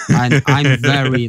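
ordinary delivery

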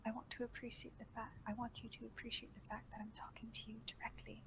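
soft, hushed, soothing voice; calm him as you confess; quiet and tender throughout. Acted. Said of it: "Voice was soothing".

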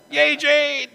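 high, playful cheer; high-pitched little hurrah. Real, not acted. Cheering someone who read names.

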